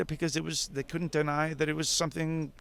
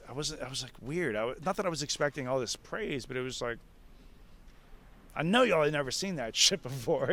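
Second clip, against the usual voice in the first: ordinary talk, nothing marked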